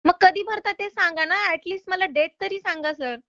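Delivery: ordinary talk, nothing marked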